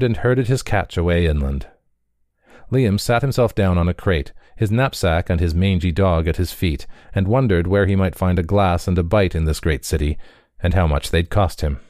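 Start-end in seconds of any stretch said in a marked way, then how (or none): none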